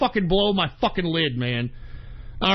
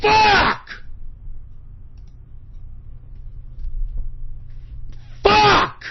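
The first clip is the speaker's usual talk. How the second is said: real enraged scream; 12 semitones higher than usual. Real, not acted. Broadcaster losing his temper on air, enraged scream. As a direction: scream the curse with full force. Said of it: "I'm so fucking pissed off".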